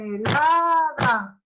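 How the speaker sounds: "Mermelada" slow, beat by beat; syllable beats. Shown as a demonstration. Teacher to class, counting syllables aloud. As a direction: clap "Mermelada" slowly. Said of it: "O mermelada".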